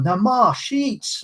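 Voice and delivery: high, drawn-out Scottish wail; Scottish accent. Retold or imitated. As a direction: play a Trainspotting mum in a Scottish accent. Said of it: "That's my Scottish accent".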